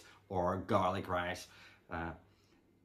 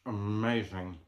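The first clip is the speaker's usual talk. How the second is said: talking while chewing; words round a bite of food; mouth full. Real, not acted. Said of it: "talking with my mouth full".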